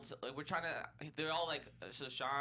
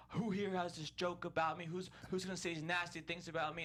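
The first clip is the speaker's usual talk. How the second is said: raised-pitch mock tirade; higher, indignant imitation of a scolding; imitated angry screaming; 6 semitones higher than usual. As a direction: act out an irate confrontation without fully screaming. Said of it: "screaming at me in a room".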